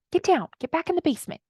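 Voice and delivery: high, mock dismissive order; mock scolding. Coach sending her inner child away, mock scolding. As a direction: shoo a child away, not raising the volume.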